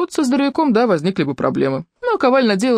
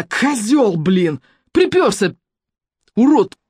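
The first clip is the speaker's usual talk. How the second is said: loud, higher spat insults; furious insults; 5 semitones higher, 3 dB louder, much slower than usual. A narrator's reading. Irka screams insults at the visitors. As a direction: hurl each insult.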